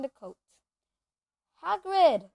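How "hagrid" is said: calling out loudly to wake someone. Acted. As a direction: call his name loud. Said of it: "said Harry loudly".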